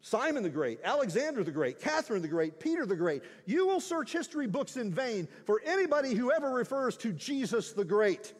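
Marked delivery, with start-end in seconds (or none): 3.5-8.3 louder, rising proclamation from the pulpit; full-force preaching. Acted. Pastor proclaiming Jesus as Savior to the congregation, loud and fervent. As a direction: preach it out with building conviction.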